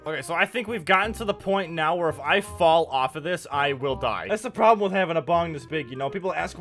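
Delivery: ordinary talk, nothing marked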